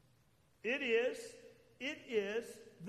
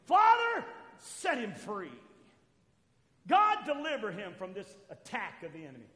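mock fervent prayer with a big shout on Father; loud imitated prayer; 3 semitones lower, 7 dB louder than usual. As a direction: ham up a loud deliverance prayer. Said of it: "what we normally do is we go".

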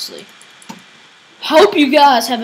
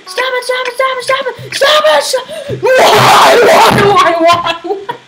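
high-pitched panicked pleading rising to a scream; beg, then scream wildly at the game; frantic screaming; 10 semitones higher, 6 dB louder than usual. Real, not acted. Frantic yelling while losing in game.

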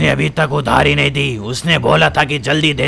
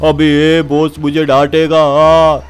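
loose, slurry thug voice with a swagger; tipsy goon; slower than usual. Acted. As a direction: play the drunk henchman lazing about. Undertaker muttering to himself. (नशे में धुत गुंडे की आवाज़)

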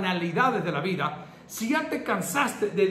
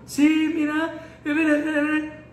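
put-on weeping old woman; 6 semitones higher, 4 dB louder than usual. Pastor imitating the crying sister, high and pleading. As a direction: cry out the words like a frantic elderly woman. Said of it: "pero lloraba".

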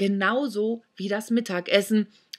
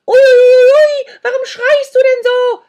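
much higher, louder whining crone, hurt ears; wailing old hag; 17 dB louder than usual. Acted. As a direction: howl and scold like a pained witch. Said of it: "herrschte sie Sandro an". (heulende alte Hexe)

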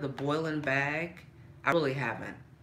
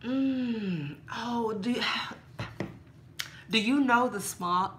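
ordinary delivery

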